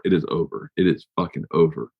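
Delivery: dropped low and soft, heavy and final; hushed announcement that it's over; quiet finality. Real, not acted. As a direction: let it sink in quietly. Dropping a bombshell about tour end.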